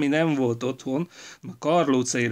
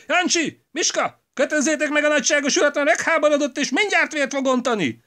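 high, loud furious orders; wife ordering servants to tie him up, enraged and loud; in a rage; 14 semitones higher, 8 dB louder than usual. A narrator's reading. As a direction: yell for the servants in fury. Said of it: "nem félelmében, hanem mérgében mondta".

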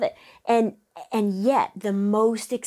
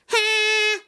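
much higher, louder cartoon whine; whiny ha demo; 5 dB louder than usual. Shown as a demonstration. Coach demonstrates the whiny ingredient, much higher and louder. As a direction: throw out a high, bright Bugs Bunny ha. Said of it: "It's like".

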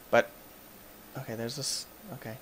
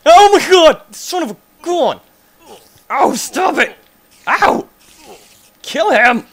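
high, very loud shrieks; panicked screaming; 17 dB louder than usual. Real, not acted. Gamer under attack by the creature, panicked screaming.

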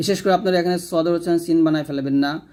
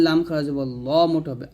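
usual speaking voice

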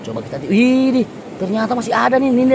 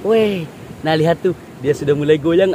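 ordinary delivery

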